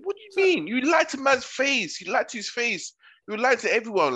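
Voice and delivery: high-pitched retold accusation; acted outrage, pitched well up; indignant retort. Retelling a row with the housemate who lied, mock indignant. Retold confrontation over lying.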